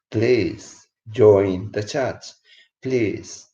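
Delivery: drawn out, every word stretched; slow, earnest plea; slow pleading. Real, not acted. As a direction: plead slowly so they listen. Pleading for viewers to join.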